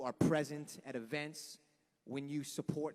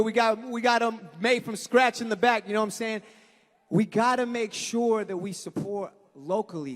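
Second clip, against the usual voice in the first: loud, high, pumped-up emcee shout to the crowd; rally the crowd like a stage host; big stage announcer energy; hyped festival MC; 5 semitones higher, 9 dB louder than usual. Acted.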